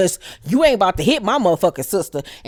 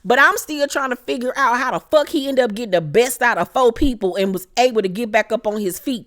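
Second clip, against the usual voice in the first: ordinary delivery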